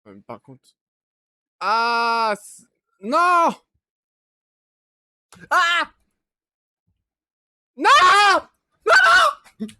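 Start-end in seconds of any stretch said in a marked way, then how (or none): 1.6-9.7 high, loud panicked cries of no; screaming in real fright; terrified screams. Real, not acted. Gamer facing a sea creature, screaming in terror.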